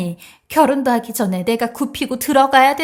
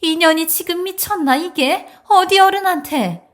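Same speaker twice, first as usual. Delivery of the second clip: higher-pitched, affronted scolding; indignant outrage; 5 semitones higher than usual. Acted. Mother-in-law-to-be rebukes a disrespectful bride, indignant. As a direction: put the upstart in their place, outraged. Insulted elder rounds on young woman.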